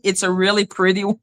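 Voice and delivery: ordinary talk, nothing marked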